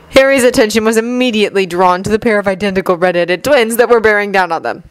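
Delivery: ordinary delivery, nothing marked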